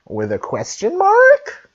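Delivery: put-on high rising Valley Girl lilt; Valley Girl uptalk. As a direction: end it on a big Valley Girl rise. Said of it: "I sound like a Valley Girl".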